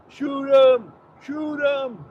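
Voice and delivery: high, hollered alligator-hunter impression; mock hunter's yell. Mimicking a gator hunter calling to Troy, high mock yell.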